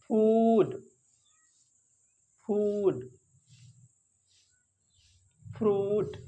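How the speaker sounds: फूड and फ्रूट with a long rounded oo; long oo sound. Shown as a demonstration. Teacher showing students the long oo. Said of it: "ये ऊ की आवाज़ निकलती है".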